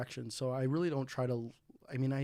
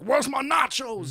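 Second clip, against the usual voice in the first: rude customer; 12 semitones higher, 11 dB louder, slower than usual. Retold or imitated. Angry customer voiced in story.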